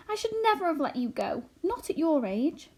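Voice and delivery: wailing. A narrator's reading. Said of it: "wailed Homily".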